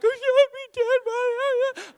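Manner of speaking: mock blubbering in a very high, squealed voice; hammed-up tearful gibberish, loud; wrecked. Dad overcome by son's words, mock bawling. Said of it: "wrecked me. I'm like".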